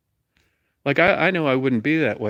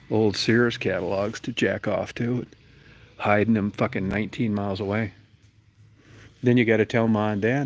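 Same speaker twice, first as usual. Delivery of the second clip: usual speaking voice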